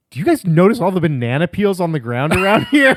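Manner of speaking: a hammed-up retelling of his wife; imitating wife. Wife's quote in retold story.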